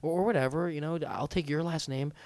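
soft, tender, trailing-off wooing; feigned earnest suitor. Acted. Laying it on thick to Camila, feigned tenderness. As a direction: murmur it as if planning a future. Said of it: "I'm like".

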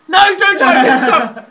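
high, loud, laughing objection; loud protest. Real, not acted. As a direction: shout to stop them, half laughing. Protesting during search contest.

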